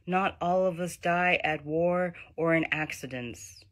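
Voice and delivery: emphatic, measured recital to a brother; poetry reading. Acted. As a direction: recite it as an address to a younger brother. Reads poem addressed to brother.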